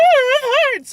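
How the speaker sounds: extremely high mock-unicorn shriek; screechy unicorn. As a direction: squeal it like a cartoon unicorn. Mimicking screeching unicorns from a cartoon.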